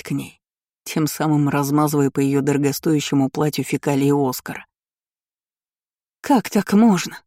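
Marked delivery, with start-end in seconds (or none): 6.2-7.2 high-pitched reproach of an upset mother; indignant noblewoman. A narrator's reading. Aristocrat hugs her son and reproaches the narrator, indignant. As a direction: protest in wounded dismay. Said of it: "часто дышала аристократка".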